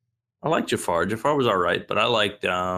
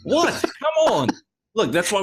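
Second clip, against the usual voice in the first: an incredulous, much higher yelp of protest; high-pitched protest; 11 semitones higher than usual. Real, not acted. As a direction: shoot right up high in disbelief. Mock protest at partner's joke.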